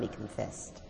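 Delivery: usual speaking voice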